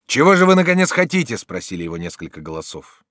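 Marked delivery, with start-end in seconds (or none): 0.1-1.4 high, loud demand; impatient shout. A narrator's reading. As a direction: demand it as a crowd would. Several attackers demand an answer. (нетерпеливый громкий выкрик)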